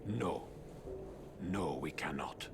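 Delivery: very low, hushed resolve; low and weighty; solemn agreement. Acted. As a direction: agree gravely, deep in the voice. Father reluctantly agrees with sons.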